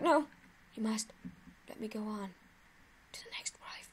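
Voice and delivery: trailing off into a faint dying whisper; fading deathbed whisper. Retold or imitated. Imitating a teammate fading into a dying whisper. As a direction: let the voice die away. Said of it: "And he's like".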